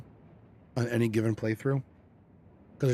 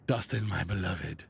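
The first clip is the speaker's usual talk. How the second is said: lightly voiced possum, low and soft; 6 semitones lower, 5 dB quieter than usual. Voicing possum's line.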